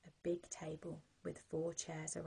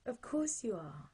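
reassuring mum; 5 semitones higher, 6 dB louder than usual. Acted. Mum laughing reply.

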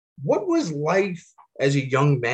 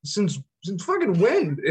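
retold disbelief; 7 semitones higher, slower than usual. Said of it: "And I was like".

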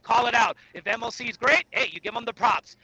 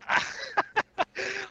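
high-pitched laugh, softer than his talk; 9 semitones higher, 5 dB quieter than usual. Real, not acted. Caller greeted by the podcast hosts, high laugh. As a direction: let out a high, light laugh.

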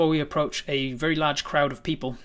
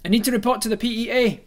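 calling out; 9 semitones higher, 7 dB louder than usual. Acted. Character calling over crowd.

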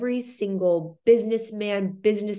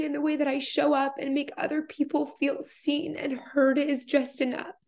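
higher, voice on the edge of tears; choked up, real tears close; getting emotional; 7 semitones higher than usual. Real, not acted. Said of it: "I'm sorry I'm getting emotional".

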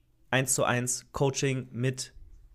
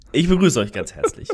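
laughing welcome; 9 dB louder than usual. Real, not acted. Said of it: "ich muss noch mal neu machen".

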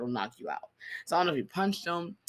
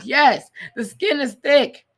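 slow, loud, higher sarcastic echo; 9 semitones higher, 12 dB louder, much slower than usual. Pretend. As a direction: drag it out mockingly. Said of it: "I'm like".

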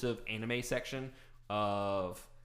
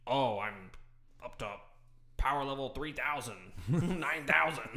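anime hero voice; 3 semitones higher than usual. Retold or imitated. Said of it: "It's all".

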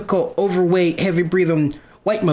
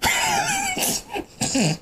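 high-pitched burst of laughter; 4 dB quieter than usual. Real, not acted. Own laugh at existentialism question.

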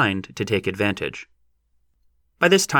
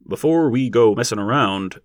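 warning; 4 dB louder than usual. A narrator's reading. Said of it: "said a warning voice".

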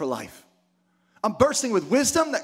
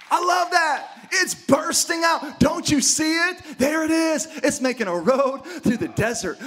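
preaching shout; 7 semitones higher than usual. Acted. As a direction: call it out like revival fire to the church.

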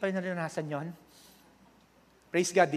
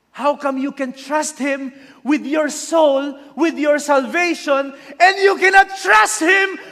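slow, much higher and louder pleading shout; preacher's shouted rebuke; 13 semitones higher, 14 dB louder, slower than usual. Acted. Pastor's own shouted version of the disciple's rebuke, full force. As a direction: hammer the question at them, slowly. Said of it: "Hindi ganun yung pagkakasabi niya, ako lang yon".

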